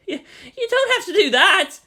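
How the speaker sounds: high, loud, laughing panic; a boy flustered by an offer, protesting with a laugh. Acted. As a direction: blurt it out in embarrassed alarm.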